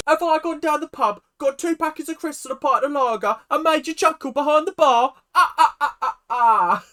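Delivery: high, loud hammed-up British accent; over-the-top British joke voice; mock British comedian. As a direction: deliver a bad pub joke as a parody Brit, cackling after it. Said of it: "I can't even get through the joke".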